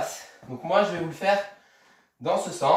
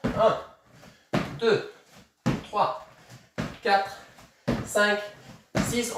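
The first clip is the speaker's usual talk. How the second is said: quicker count, shorter gaps; faster count; much slower than usual. Shown as a demonstration. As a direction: pick up the pace on the count. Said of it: "on repart un peu plus vite". (compter un peu plus vite)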